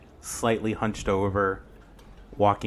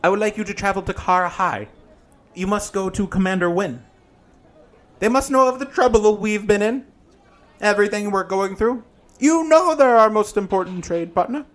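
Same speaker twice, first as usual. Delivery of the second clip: campy drag queen; 12 semitones higher, 9 dB louder than usual. Acted. GM voices Granny Who.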